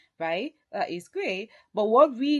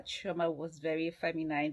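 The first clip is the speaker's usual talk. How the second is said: normal talking voice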